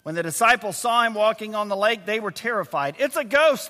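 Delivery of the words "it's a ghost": higher-pitched, mildly alarmed cry; a touch of fright; fearful cry. A narrator's reading. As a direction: read it as a restrained cry of fear. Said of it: "cried out in fear".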